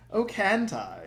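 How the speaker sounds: mocking challenge.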